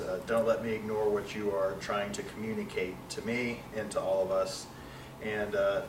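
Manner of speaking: low, soft spoken prayer. Real, not acted. Closing an opening prayer before Bible study, low prayerful voice. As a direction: pray quietly and humbly, with small pauses.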